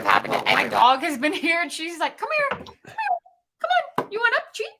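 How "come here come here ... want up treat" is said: high coaxing call to a pet; talking to a dog. Real, not acted. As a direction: coax the dog up, sing-song high. Calling her dog over.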